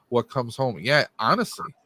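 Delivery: usual speaking voice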